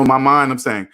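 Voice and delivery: ordinary talk, nothing marked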